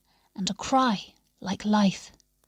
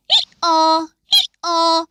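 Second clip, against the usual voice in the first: loud high hee-haw bray; donkey bray; 8 semitones higher, 7 dB louder than usual. A narrator's reading. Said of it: "I brayed and brayed".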